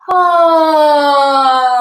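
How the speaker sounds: sliding sigh on ah, from high down; gentle sigh glissando. Shown as a demonstration. Teacher demonstrating a sighing glissando. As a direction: let an ah slide down like a sigh. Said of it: "two more of those".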